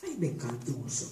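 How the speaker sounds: whispered. A narrator's reading. Ndongo whispers her real name beside Tutuba, softly. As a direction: keep your true name hidden. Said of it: "fluisterde Ndongo".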